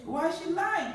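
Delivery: ordinary talk, nothing marked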